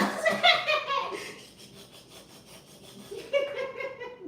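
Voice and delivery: long high laughing fit. Real, not acted. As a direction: keep laughing through it. Laughing at her ASMR joke.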